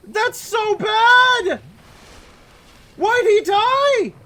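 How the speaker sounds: high, loud, pleading cries of disbelief; distressed outburst. Real, not acted. Player howling at the screen over the ally's death, high and loud. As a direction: wail it at the screen again and again. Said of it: "Oh, I'm so mad".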